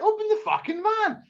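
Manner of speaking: very high-pitched angry demand; exasperated demand. Retold or imitated. Locked out of the van, exasperated demand. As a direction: pitch shoots up in retold exasperation.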